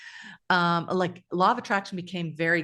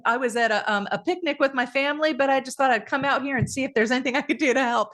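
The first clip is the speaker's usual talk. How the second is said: higher, quick Southern voice; mock Southern accent; 6 semitones higher, faster than usual. Passer-by who left a family picnic to help, mock Southern accent. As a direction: imitate a friendly helper with a Southern twang. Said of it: "I'm saying it like a Southern accent".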